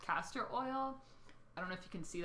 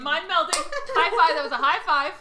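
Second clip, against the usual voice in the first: louder, higher burst of excitement; excited; 8 semitones higher, 13 dB louder than usual. Real, not acted. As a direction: cheer the shared joke loud and high. Excited celebrating with co-host.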